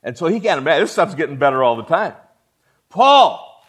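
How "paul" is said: loud high call of a name. Acted. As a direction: call him from across heaven. God calls out to Paul.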